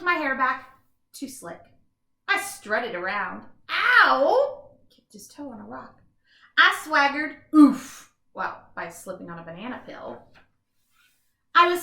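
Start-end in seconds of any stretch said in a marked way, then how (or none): 3.7-4.7 loud, higher yelp of pain. Acted. Voicing the bean kicking his toe. 7.5-8.1 loud grunt as he falls; oof of a fall. Acted. Voicing slipping on banana peel.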